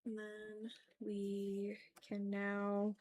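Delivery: ordinary talk, nothing marked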